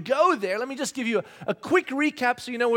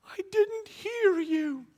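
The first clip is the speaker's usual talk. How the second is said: put-on high, slow little-boy voice; innocent kid voice; 10 semitones higher, much slower than usual. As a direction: play the innocent child. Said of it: "looks at me in my eyes and goes".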